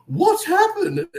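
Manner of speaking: high, drawn-out mock horror; gasp, then imitate an aghast onlooker; shocked gasp. Retold shocked reaction of extras.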